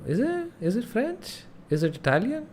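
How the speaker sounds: high-pitched disbelieving rise; surprised question. Real, not acted. Streamer suddenly doubting his guess, surprised high rise. Streamer realizing the country is France.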